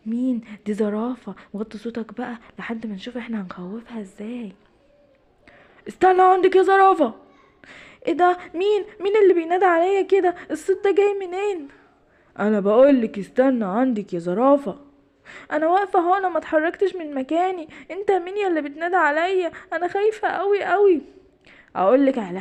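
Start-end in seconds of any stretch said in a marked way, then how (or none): 1.5-4.5 much softer, conspiratorial; hushed. Acted. Ardon hushes Simba while they plan to scare the giraffe, softly. Hushing before scaring the giraffe. 5.9-7.1 higher and louder spooky call. Acted. Ardon calls down from the tree house to scare the giraffe, loudly. 8.1-21.0 a higher, nervous giraffe voice; frightened giraffe. Acted. The giraffe, hearing a hidden voice, answers higher and frightened.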